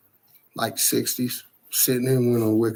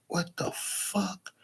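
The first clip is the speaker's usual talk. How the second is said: soft, slow, higher-pitched shock; hushed disbelief; 8 semitones higher, 11 dB quieter, slower than usual. Retold or imitated. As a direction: say it under your breath, stunned and drawn out. Retold shock at figures in mirror.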